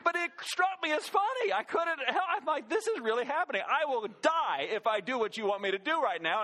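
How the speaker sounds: amused disbelief. Real, not acted. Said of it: "I started laughing hysterically".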